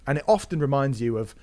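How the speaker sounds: ordinary talk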